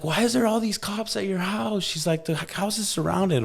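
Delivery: lightly voiced woman, pitched high; alarmed babysitter voice, high; worried neighbor lady. Neighbor phoning about cops at the house, high worried.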